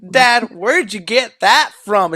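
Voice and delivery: slow drawled southern Dad line; mock southern accent. Daughter asking her dad, mock southern accent. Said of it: "her accent changes".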